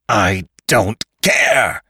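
slow, drawn-out angry cry; screamed defiance. A narrator's reading. Attacker refuses to listen as they wrestle, screamed defiance. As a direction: stretch each word into a defiant cry. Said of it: "screamed Lantry".

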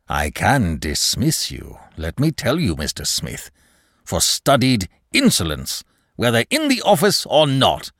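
irate manager. Acted. Manager threatens clerk with dismissal, irate. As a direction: begin deliberately, then rise in indignation.

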